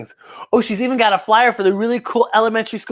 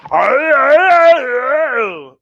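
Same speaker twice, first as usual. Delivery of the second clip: mock vampire growl; 5 semitones higher, 7 dB louder, much slower than usual. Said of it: "The vampire's going".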